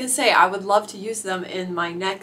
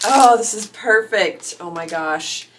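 usual speaking voice

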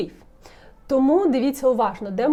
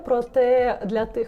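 ordinary talk

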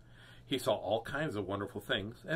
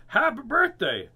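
high, loud happy shout; birthday greeting; 11 semitones higher, 9 dB louder than usual. Acted. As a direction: sing out the greeting at full voice. Turtle shouts greeting in story.

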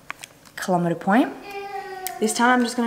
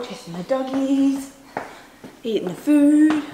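high, sing-song pet talk; talking to the dog; 5 semitones higher, slower than usual. Real, not acted.

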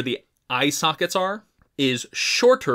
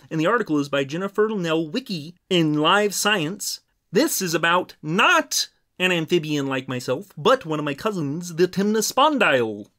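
slightly high, far-from-natural Muppet voice; Kermit the Frog; 4 semitones higher than usual. Pretend. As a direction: put on a playful frog-puppet impression. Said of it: "in your Kermit the Frog voice".

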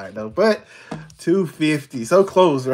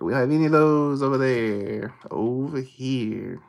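usual speaking voice

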